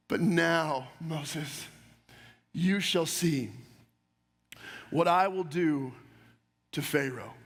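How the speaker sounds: God promising Moses what he will do, hushed and deep; solemn voice of God. Acted. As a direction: speak as God, low and slow with weight. God's powerful statement to Moses.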